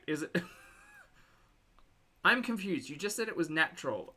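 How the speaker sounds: ordinary talk, nothing marked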